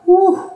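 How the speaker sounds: high-pitched mock sigh of relief; relieved whew. Worker relieved at boss's friendly hello, exaggerated high whew.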